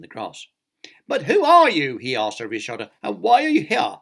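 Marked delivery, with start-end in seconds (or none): 1.1-2.0 high, loud questioning; exasperated demand. A narrator's reading. Upset character demanding answers. 3.0-4.0 high, loud questioning; exasperated demand. A narrator's reading. Upset character demanding answers.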